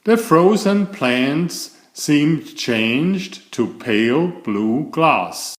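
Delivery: usual speaking voice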